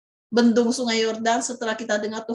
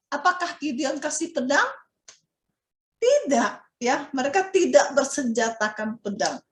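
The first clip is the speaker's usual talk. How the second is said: ordinary delivery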